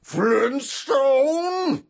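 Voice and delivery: high, loud cartoon yell; hammed-up cartoon shout; broad cartoon voice. Shown as a demonstration. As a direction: belt it like an old cartoon. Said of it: "broad things like that".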